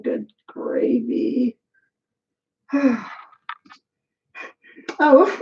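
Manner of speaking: ordinary talk, nothing marked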